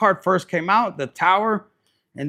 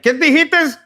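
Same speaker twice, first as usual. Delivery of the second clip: high, loud mock outrage; jealous boyfriend; 11 semitones higher, 10 dB louder than usual. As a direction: snap back at your girl. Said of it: "your dude's like".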